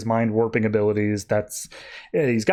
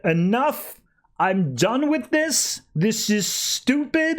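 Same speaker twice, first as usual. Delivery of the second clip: fed-up outburst; 9 semitones higher, much slower than usual. Retold or imitated. Tim fed up, hitting villain.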